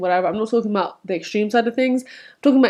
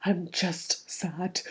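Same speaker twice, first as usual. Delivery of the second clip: softer, very slow and sad, retold; mock sad; 12 dB quieter, much slower than usual. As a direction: let it sag like a tired sigh. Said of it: "for me, it's like".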